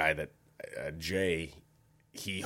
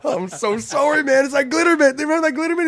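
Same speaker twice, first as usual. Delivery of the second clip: frantic apology; 11 dB louder, faster than usual. Retold or imitated. Pleading apology to friend.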